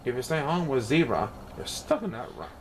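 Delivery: usual speaking voice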